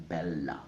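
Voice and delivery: bella with a sounded l, BEL-la; Italian pronunciation. Shown as a demonstration. Teacher showing the familiar Italian version, bella with l. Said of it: "Italian way of saying this".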